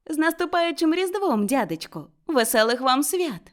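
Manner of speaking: high, cheerful holiday greeting; cheerful shout. A narrator's reading. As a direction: call out gladly to your uncle. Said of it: "почувся життєрадісний вигук". (радісний вигук)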